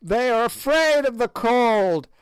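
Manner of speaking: high sneering witch; old witch voice. A narrator's reading. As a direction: play the ancient hag taunting the king. Gagool's rant continues.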